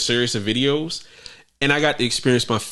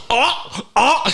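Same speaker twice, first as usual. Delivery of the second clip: YouTuber voice; 10 semitones higher than usual. Pretend. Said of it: "I'm putting on my YouTuber voice".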